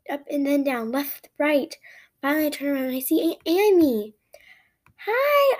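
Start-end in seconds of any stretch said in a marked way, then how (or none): 5.1-5.6 high, loud greeting; excited call. A narrator's reading. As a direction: call out eagerly to someone arriving. Calls out running towards car.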